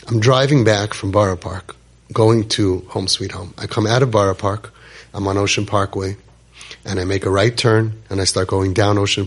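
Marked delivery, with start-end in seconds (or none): none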